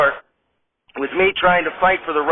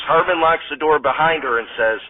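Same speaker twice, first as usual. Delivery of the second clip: ordinary talk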